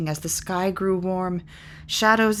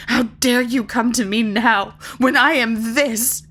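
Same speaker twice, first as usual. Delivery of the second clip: higher, louder accusing questions; bitter outburst; 5 semitones higher, 5 dB louder than usual. Acted. Molly accuses the unicorn of coming twenty years too late, loud. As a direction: throw each question at her.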